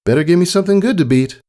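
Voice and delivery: high, quick, teasing filly voice; snarky grin. Acted. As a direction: tease her rival with a grin, fast. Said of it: "Her grin was back, a little snarky".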